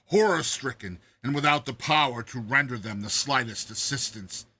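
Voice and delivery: usual speaking voice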